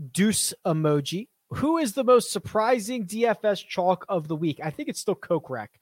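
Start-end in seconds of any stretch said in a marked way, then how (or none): none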